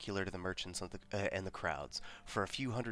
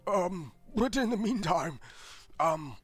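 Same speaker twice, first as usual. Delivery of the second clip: higher, louder burpy clerk; burping the words; 12 semitones higher, 7 dB louder than usual. Acted. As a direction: talk through burps. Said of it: "He's just talking through burps".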